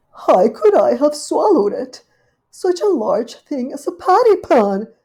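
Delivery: high, loud dismayed lady dog; fretting Duchess. Acted. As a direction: wring your paws over it. Duchess frets aloud.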